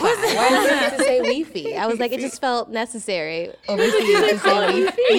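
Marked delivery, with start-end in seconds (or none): 0.0-1.8 mocking local. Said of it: "And it's like". 2.1-5.2 high, louder, laughing put-on fancy voice; mock-posh pronunciation, giggling; laughing fancy accent. Explaining to a local in Amsterdam, laughing mock-posh. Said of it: "felt fancy".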